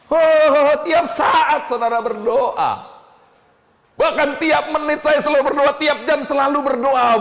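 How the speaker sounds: high, ringing sermon delivery; full-force preaching. Acted. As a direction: preach it out to the congregation.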